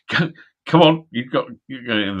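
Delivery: mock-angry mum hurrying him out. Mum dragging her drunk son from the club, crossly. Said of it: "looking extremely pissed off".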